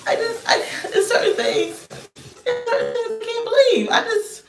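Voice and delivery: real giggling, words squeaking out; laughing disbelief. Real, not acted.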